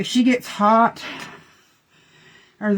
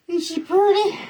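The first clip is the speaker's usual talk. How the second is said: much higher cooing pet voice; 11 semitones higher than usual. Real, not acted. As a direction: gush over the dog in a high coo. Baby talk to her dog.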